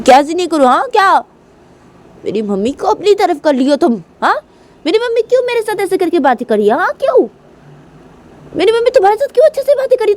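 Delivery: very high-pitched, heated outburst; furious, jealous girl. Acted. Kajal demanding answers from Reshma, high and angry. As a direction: confront the rival, pitch soaring on each demand.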